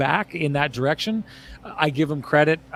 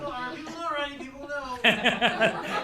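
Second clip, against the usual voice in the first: long amused laugh; high laugh; 10 semitones higher, 5 dB quieter than usual. Real, not acted. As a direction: crack up laughing. Laughing after his joke.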